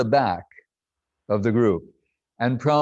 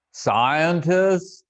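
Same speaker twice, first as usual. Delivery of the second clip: lightly imitated Prabhupada, higher, calling the word as a question; calling out Scientist; 5 semitones higher than usual. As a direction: call out the word Scientist like a summons. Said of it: "Just like that".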